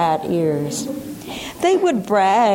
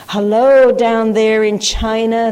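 shouting; 3 semitones higher, 6 dB louder than usual. A narrator's reading. Men calling down a hole to China, loud shout. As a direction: holler it down to China. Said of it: "they shouted".

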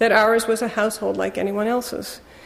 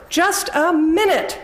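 higher, slow, cutting words; hurled sharply; 8 semitones higher, slower than usual. Acted. Mother snapping at her son to wait, cutting. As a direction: slice him off mid-plea. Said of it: "hurled at him as if the word were a blade".

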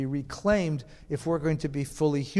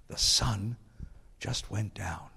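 put-on hushed, breathless punchline with a European accent, much softer; 6 semitones lower, 6 dB quieter, slower than usual. A lecturer imitates Heschel giving his sunset punchline, breathless and hushed. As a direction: drop it softly like the small old rabbi revealing the miracle. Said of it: "in a breathless voice with a European accent".

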